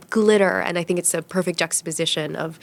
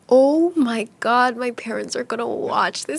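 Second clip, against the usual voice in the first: shocked realization; 4 semitones higher than usual. Retold or imitated. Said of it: "I thought".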